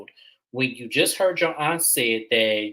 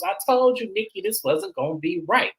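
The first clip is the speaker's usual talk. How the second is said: imitating upset aunt; 8 semitones higher than usual. Voicing Aunt Jackie in the bedroom.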